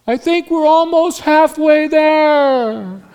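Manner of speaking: high, drawn-out joking holler from the back; Dave calling from behind. Retold or imitated. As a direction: sing it out slowly to the group far ahead. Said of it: "from the back, I hear Dave say".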